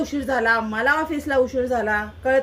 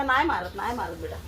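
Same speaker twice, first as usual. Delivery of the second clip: calm the cat down gently; soft soothing; 7 semitones lower, 5 dB quieter than usual. Real, not acted. Owner soothing the scared cat, lower and softer. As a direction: lower and softer, hushed comfort.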